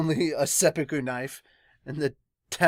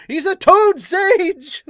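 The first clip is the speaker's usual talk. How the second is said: much higher, much louder, slow cry; excited exclamation; 14 dB louder, much slower than usual. Real, not acted. Fan gleefully shouting about a toad sage, much higher and louder. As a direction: shout it with delight.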